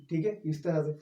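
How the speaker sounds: ordinary talk, nothing marked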